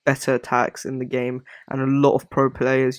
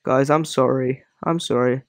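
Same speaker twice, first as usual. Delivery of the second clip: ordinary delivery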